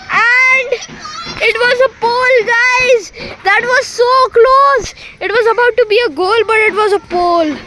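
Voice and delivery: loud, high cry of a near miss; excited play-by-play. Real, not acted. Commentator telling viewers it hit the pole, loud excited call. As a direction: call the near goal at full voice.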